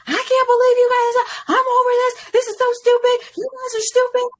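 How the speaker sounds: high, loud retold rant; yelling at TSA agent. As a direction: fire off an imitated tirade at the agent. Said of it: "I'm yelling at the T S A agent".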